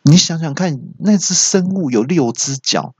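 ordinary talk, nothing marked

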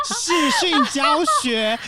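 high, loud words squeezed through laughter; laughing while talking. Real, not acted. Telling friends about video-call coaching, laughing through it. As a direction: keep laughing as you say it.

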